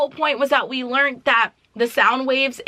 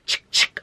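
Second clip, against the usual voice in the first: whispered gun cock sound. Retold or imitated. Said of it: "she goes".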